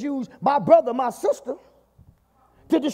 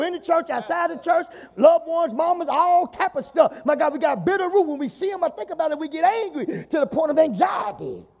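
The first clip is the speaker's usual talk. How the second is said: full-force preaching; 5 semitones higher than usual. Real, not acted. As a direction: preach it out hard to the congregation.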